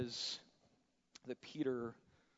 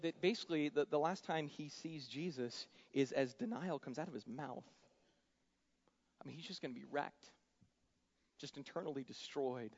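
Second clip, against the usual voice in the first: ordinary talk, nothing marked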